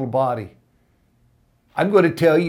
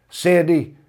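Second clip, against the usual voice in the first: usual speaking voice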